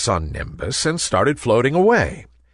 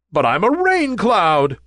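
high, whiny protest; moaning; 9 semitones higher, 4 dB louder than usual. A narrator's reading. Nimbus protesting to the Sun, moaning. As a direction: moan it high like an upset little cloud. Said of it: "moaned Nimbus".

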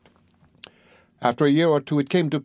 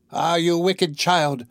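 slow, higher, outraged enchantress; witch's angry cry; 5 semitones higher, slower than usual. A narrator's reading. Enchantress scolding Rapunzel for her secret, slow outraged cry. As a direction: spit out the words like a betrayed witch. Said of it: "cried the enchantress".

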